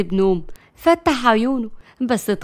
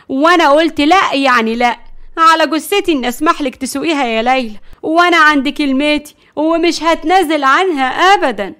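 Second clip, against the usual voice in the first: loud, raised-pitch firm no; adamant refusal; 4 semitones higher, 6 dB louder than usual. Acted. Grandmother forbids Layla to drive, adamant and loud. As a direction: put your foot down, emphatically.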